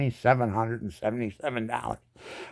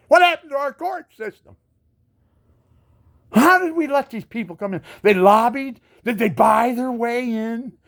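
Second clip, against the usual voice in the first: higher, louder, exasperated questions; indignant; 10 semitones higher, 4 dB louder than usual. Real, not acted. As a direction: demand answers, outraged. Angry rant at company.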